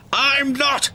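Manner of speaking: high, cross protest; touchy, high-pitched snap; cranky denial. A narrator's reading. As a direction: deny it indignantly. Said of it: "Cranky cranked".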